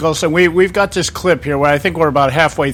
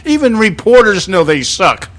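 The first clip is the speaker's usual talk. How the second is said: ordinary talk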